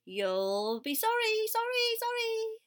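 loud call leaping high on each sorry; singsong, high goose call; called warning. A narrator's reading. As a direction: honk a drawn-out warning that climbs high. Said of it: "called the goose".

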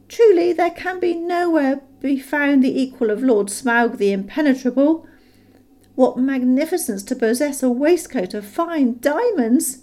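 butter up the dragon; hobbit flattery. A narrator's reading. Bilbo flattering Smaug, high and eager.

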